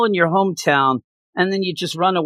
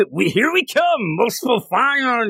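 higher, drawn-out mock yell; mock battle scream; 9 semitones higher, slower than usual. Scourge charging into Muspelheim, higher mock war cry. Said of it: "you know, scream".

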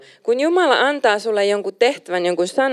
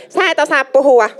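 higher sneering jab, a touch of a taunt; mocking accuser; 5 semitones higher than usual. Preacher mimics the doubting voice, mocking higher. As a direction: mimic the voice that mocks you, pitched up. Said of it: "sitä ääntä".

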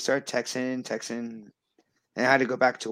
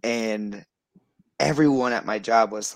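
ordinary delivery

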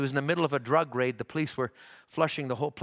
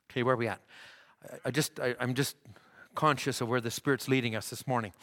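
ordinary delivery

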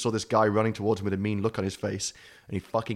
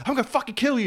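much higher threatening rock star voice; mock threat; 4 dB louder, faster than usual. Nikki threatening the fan in a high mock voice. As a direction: squeal out a threat.

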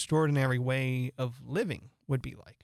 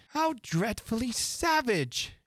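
exclaiming; 10 semitones higher, slower than usual. A narrator's reading. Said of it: "exclaimed Alice".

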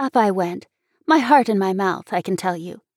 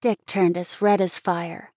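ordinary delivery, nothing marked

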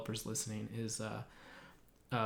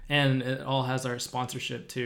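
usual speaking voice

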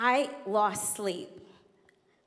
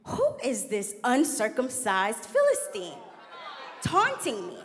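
defiant declaration; 2 semitones higher than usual. Retold or imitated. Speaking back to the spirit of fear, defiant and building. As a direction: build it into a battle cry. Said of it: "And I said".

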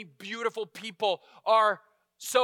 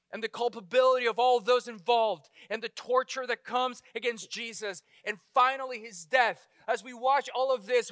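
ordinary talk

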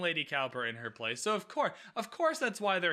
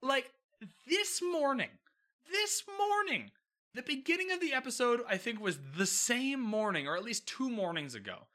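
exasperated mock rant at Kate; exasperated disbelief; 6 semitones higher than usual. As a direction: call her out, incredulous. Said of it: "And I was like".

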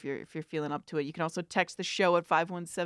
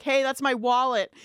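firm call-out; 5 semitones higher, 4 dB louder than usual. Retold or imitated. Knocks on glass, finally heard.

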